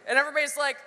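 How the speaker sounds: usual speaking voice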